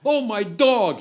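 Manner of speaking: high, loud fake wail; mock despair. Jack improvising grief in front of the band, hammed-up and high. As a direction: grieve a dog he never had. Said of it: "pretty good improv".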